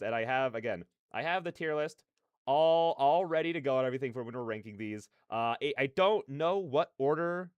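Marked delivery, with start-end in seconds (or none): none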